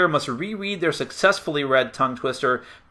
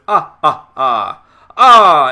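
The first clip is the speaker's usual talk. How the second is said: loud, hammed-up Dracula-style "ah, ah" laugh; vampire cackle; 10 dB louder, much slower than usual. Shown as a demonstration. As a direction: cackle like a cartoon vampire count. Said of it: "speak like a Transylvanian vampire".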